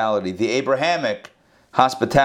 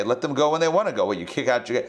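ordinary talk, nothing marked

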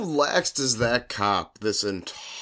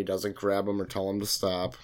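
normal talking voice

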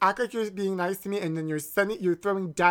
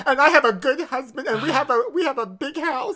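shrill overlapping reality fight; 8 semitones higher, 4 dB louder than usual. Acted. Boasting in mock fight.

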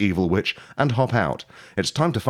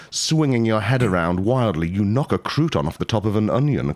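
ordinary delivery

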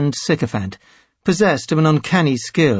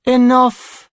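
high, weary command with a sigh; 11 semitones higher, 4 dB louder than usual. A narrator's reading. High Priest halting his clerics' bickering, sighing. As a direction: sigh it out to stop the squabble. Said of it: "Tenebrae sighed".

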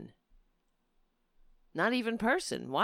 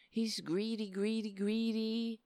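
imitating Trump; 7 dB quieter than usual.

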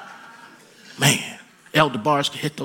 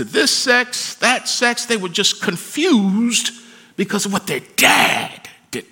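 high-pitched, insistent sermon delivery; impassioned preaching; 7 semitones higher than usual. Real, not acted. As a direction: preach it at full force, voice raised high. Preacher builds to full force.

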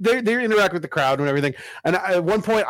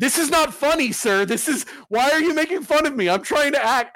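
high, indignant actor scolding the audience; offended actor; 9 semitones higher than usual. Retold or imitated. Actor scolding a laughing audience member, mock indignant. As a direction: play the wounded stage actor, pitched up. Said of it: "the guy looks at me and goes".

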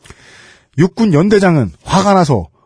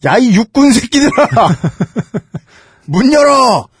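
put-on drunk shouting; 8 semitones higher, slower than usual. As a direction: bellow at the gate like a drunk. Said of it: "혀가 꼬여".

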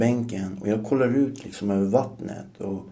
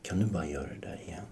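unvoiced, lower whisper after a deep breath; breathy whispered plea; 3 semitones lower, 11 dB quieter, faster than usual. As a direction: beg him in a dreamy whisper. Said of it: "tar jag så här djupt andetag".

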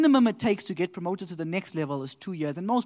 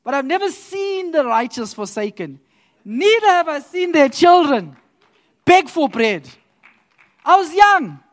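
high, loud proclaiming of the psalm; preaching scripture; 7 semitones higher, 10 dB louder than usual. Acted. Quoting David to the congregation, preaching loudly. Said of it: "David tells us, he says".

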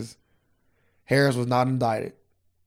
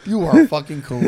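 loud, high laughing through the words; 6 semitones higher, 9 dB louder than usual. Real, not acted. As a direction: laugh hard while talking. Laughing at friend's remark.

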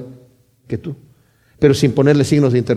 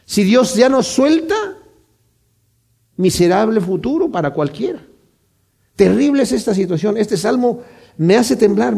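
higher, urgent sermon voice; impassioned preaching; 8 semitones higher than usual. Acted. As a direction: press the dread home to the congregation. Predica con intensidad creciente. (predicador que estremece a la congregación, voz alta y urgente)

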